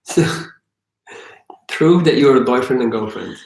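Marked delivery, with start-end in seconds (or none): none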